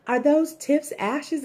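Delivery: high, loud and slow frightened question; retold alarmed question, high and loud; panicked. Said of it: "she's freaking out".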